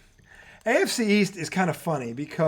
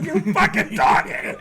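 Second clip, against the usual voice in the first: slow, laughing mock rage; mock angry yell at TV; 4 semitones higher, 4 dB louder than usual. Fan at the TV, mock furious yell. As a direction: yell at the receiver through a laugh.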